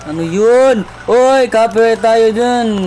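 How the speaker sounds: mock warning, loud and drawn out; loud surprised call. Real, not acted. Reacting to jingle.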